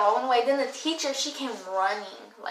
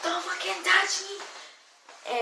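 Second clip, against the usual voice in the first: high, slow and drawn-out threat; furious warning; 8 semitones higher, much slower than usual. Retold or imitated. To the teacher pulling her off, a slow high angry warning. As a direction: warn them off, word by word.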